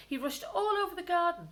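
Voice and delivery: ordinary delivery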